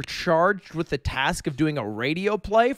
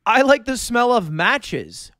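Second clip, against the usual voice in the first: defensive; 5 semitones higher, 4 dB louder, slower than usual. Retold or imitated. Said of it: "And I was like".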